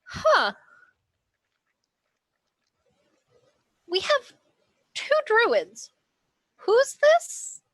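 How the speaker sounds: high, loud double take; startled surprise. Acted. Finn spots a giant panther for the first time, startled. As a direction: pitch climbing as the surprise sinks in. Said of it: "sees a giant panther for the first time".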